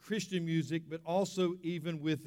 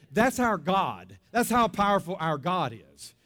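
preacher rising to a climax on God's power; preaching climax; 6 dB louder than usual. Real, not acted. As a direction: proclaim it over the music. Preacher climax over music.

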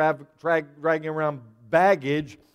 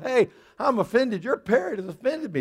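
higher-pitched comic indignation; mock offended; 6 semitones higher than usual. Customer complaining to the shop owner, mock offended. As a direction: complain in a raised, affronted voice.

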